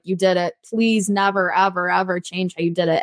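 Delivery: ordinary talk, nothing marked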